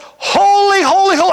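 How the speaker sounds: loud high proclaiming shout; booming holy cry; angelic shout. Shown as a demonstration. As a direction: cry it out like a seraph calling across the temple. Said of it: "I won't go that loud".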